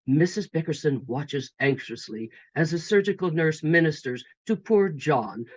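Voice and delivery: usual speaking voice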